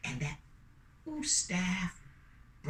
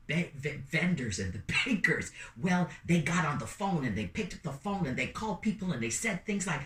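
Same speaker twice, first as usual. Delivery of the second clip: ordinary delivery, nothing marked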